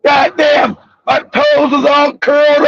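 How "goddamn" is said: ordinary talk, nothing marked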